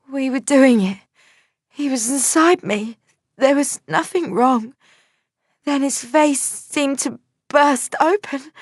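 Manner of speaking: put-on girl in shock recalling her lover's death; dazed girl. A narrator's reading. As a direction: voice the shocked young woman. Girl voiced, describing the death.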